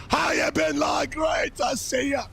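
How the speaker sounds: loud, high hype greeting; hype shout. Real, not acted. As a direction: yell it out to the chat, pumped up.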